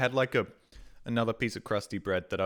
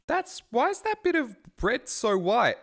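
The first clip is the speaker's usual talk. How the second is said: alarmed surprise; 13 semitones higher, 5 dB louder than usual. Retold or imitated. Noticing mold on his sandwich.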